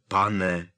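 pane said pointedly and loud; stress on pane. A narrator's reading. Harry pointedly calls Hagrid sir, stressing "pane". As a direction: lean on "pane" on purpose. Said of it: "úmyslně zdůraznil druhé slovo".

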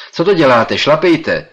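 loud, high stern order; stern command. Acted. Professor sternly orders the braking narrator, loud and high. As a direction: bark at him to keep pedalling. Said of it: "přikázal přísně profesor".

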